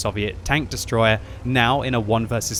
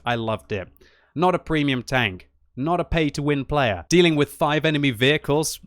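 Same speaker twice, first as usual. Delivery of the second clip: ordinary talk, nothing marked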